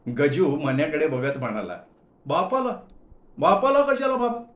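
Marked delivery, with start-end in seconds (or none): 2.2-4.4 "बापाला" rising much higher in disbelief; baffled, squeaky disbelief; bewildered. Acted. Manya asks Gaju why meet her father, bewildered. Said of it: "गोंधळून विचारलं".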